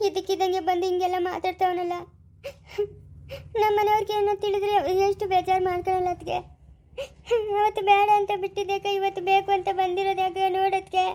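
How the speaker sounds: despairing, weeping complaint; tearful crying. Acted. Wife asking sister-in-law why God punishes her, crying. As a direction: wail out the words between sobs. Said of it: "ಅಳ್ಬೇಡ ಸುಮ್ಮನೆ ಅಮ್ಮ".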